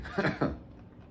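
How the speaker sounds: breathless, high, airless laugh after a cough; exasperated laugh. Real, not acted. Laughing at herself after coughing mid-reading, exasperated laugh. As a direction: laugh it off, worn out. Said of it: "That laugh sounded so exasperated".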